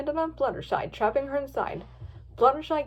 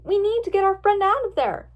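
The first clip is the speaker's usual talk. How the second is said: high, louder appeal; raised, insistent plea; begging urgently; 8 semitones higher, 6 dB louder than usual. A narrator's reading. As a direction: plead for a trapped friend. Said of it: "begged Twilight".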